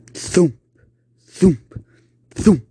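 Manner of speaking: loud vocal thumps; thumping noise. Acted. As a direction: knock out each thump.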